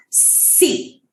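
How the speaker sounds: a soft voiceless hiss of सी. Shown as a demonstration. Teacher isolating the s sound for students. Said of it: "So it, that whole word".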